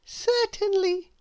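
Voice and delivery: high, slow, glad baker; pleased shopkeeper. A narrator's reading. Baker gladly serving Mister Noisy, high. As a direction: answer kindly at last. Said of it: "she said".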